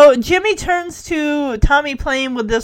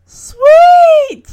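high, loud drawn-out whoop; excited cheer; 12 dB louder than usual. Real, not acted. Host cheering the news of three viable embryos, excited. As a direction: let out a long, delighted high shout.

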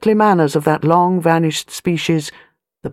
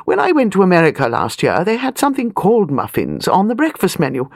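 a hint of a bossy elderly aristocrat, higher; an old lady of rank, talking down a little; airy grande dame, lightly done; lightly voiced imperious old lady; 6 semitones higher than usual.

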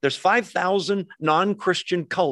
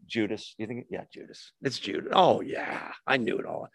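hushed aside; 4 semitones lower, 7 dB quieter than usual. Retold or imitated. Imagined disciples hushed about Judas.

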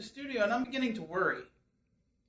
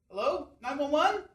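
normal talking voice

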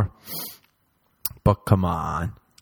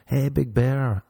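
coaxing voice; 5 semitones higher, 4 dB quieter, slower than usual. Retold or imitated. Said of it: "now I'm trying to be like".

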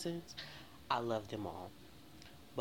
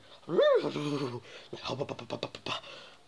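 long loud cackling laugh; 6 dB louder than usual. Real, not acted. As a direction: crack up helplessly at the thought. Cracking up at own story.

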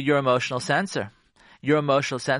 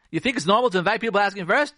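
quick, higher-pitched reproach; indignant challenge; 6 semitones higher, faster than usual. Acted. Wife confronting her husband about a guest, indignant. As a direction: rattle it off as an accusation. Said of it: "Say you say to your husband".

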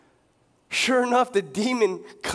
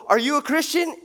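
eager preacher; 8 semitones higher, 4 dB louder than usual. Retold or imitated. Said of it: "And they go".

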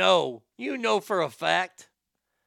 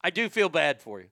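ordinary talk

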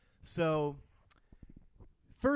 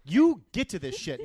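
loud rant; 9 semitones higher, 14 dB louder than usual. Real, not acted. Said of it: "ranting at the pilot".

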